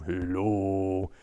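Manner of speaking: low quiet bear voice; bear puppet greeting. Acted. Reggie the bear puppet greeting, low and soft. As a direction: say it softly and low like a big shy bear. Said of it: "goes on the windowsill".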